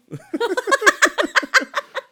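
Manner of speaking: shrieking laugh; high loud laughter. Real, not acted. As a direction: crack up loudly. Reacts to the joke.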